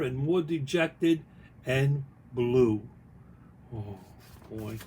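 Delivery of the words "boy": usual speaking voice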